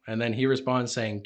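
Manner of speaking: ordinary talk, nothing marked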